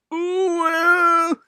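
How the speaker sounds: high loud wailing groan. A narrator's reading. Skidding engine, loud high groan. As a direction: cry out as the brakes grab. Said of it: "groaned Thomas".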